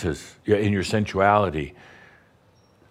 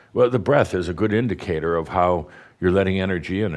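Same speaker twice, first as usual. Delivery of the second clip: usual speaking voice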